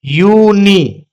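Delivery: "यूनी" very slowly, as two long syllables यू, नी. Shown as a demonstration. Teacher sounding out "यूनी" for writing in Hindi, slow syllables. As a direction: stretch out both vowels of "यूनी". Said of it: "इसको हिंदी में लिखिए पहले".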